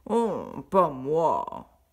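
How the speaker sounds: lent, grogné, paresseux; traînez le refus en grognant; cochon grognon. A narrator's reading. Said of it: "Le cochon se retourna et grogna". (le cochon se retourne et refuse, lent grognement)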